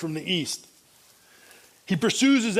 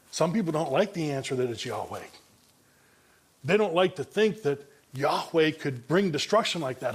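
normal talking voice

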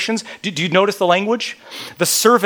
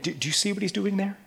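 ordinary delivery

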